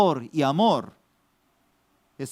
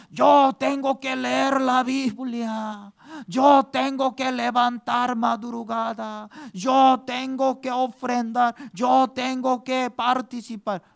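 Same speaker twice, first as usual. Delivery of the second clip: mock dutiful believer; 7 semitones higher than usual. Mocking a duty-bound believer.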